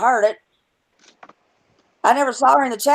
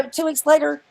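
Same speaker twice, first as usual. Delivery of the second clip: normal talking voice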